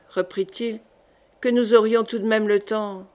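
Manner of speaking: plain reading voice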